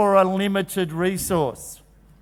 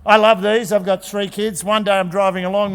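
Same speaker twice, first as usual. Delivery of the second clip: ordinary talk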